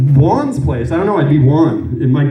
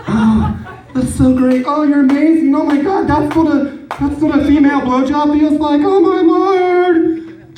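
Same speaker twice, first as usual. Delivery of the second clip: hammed-up higher gasping praise; mock ecstasy; 10 semitones higher than usual. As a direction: overplay the fake delight, pitched up. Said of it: "and just be like".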